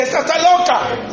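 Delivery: réplica indignada en tono agudo; exclámalo como marido ofendido, en parodia; indignado, imitado. Retold or imitated. Se imita indignado con su esposa. (indignado y ofendido)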